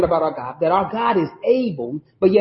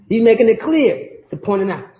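ordinary talk

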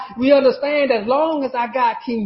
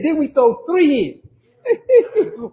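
astonished king; 6 semitones higher, much slower than usual. Retold or imitated. King's astonished question, laughing.

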